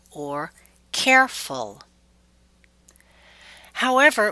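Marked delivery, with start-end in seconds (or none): 0.9-1.8 "careful" stressed on CARE, with a light -ful; suffix unstressed. Shown as a demonstration. Teacher shows the usual pattern, "careful" stressed on CARE. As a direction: hit CARE in "careful", let -ful drop. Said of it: "are not typically stressed".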